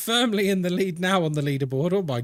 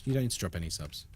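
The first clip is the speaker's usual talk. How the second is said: much softer, lower and fast, thrown away; quiet quick repeat; 9 semitones lower, 9 dB quieter, faster than usual. Real, not acted. Streamer repeating reassurance to a viewer, soft and quick. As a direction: mumble it again under your breath, quickly.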